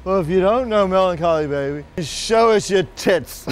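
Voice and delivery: lightly acted drunk calling out the punchline; drunk heckler. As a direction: heckle back like a tipsy lout. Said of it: "The drunk yells".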